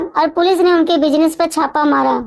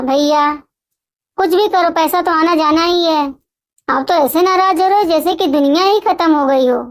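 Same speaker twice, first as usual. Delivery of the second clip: ordinary delivery, nothing marked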